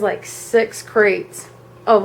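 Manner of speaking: plain reading voice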